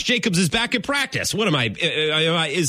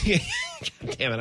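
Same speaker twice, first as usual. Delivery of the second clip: laughing; 6 dB quieter, much slower than usual. Real, not acted. Caught by co-host's joke.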